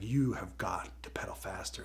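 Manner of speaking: hushed urgent command; mock urgent whisper. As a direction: hiss the order like an urgent coach. Boss Baby urging Tim on.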